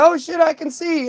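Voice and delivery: high, excited shout of wonder; suddenly able to see, thrilled and high; amazed. Retold or imitated. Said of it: "he's like".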